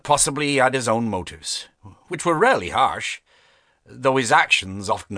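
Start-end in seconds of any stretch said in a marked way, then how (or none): none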